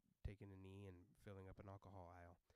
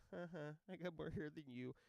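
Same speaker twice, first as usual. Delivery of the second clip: higher, louder playful taunt; teasing boast; 6 semitones higher, 6 dB louder than usual. Retold or imitated. Dad taunting his kid about hair, teasing. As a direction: gloat at a kid in fun.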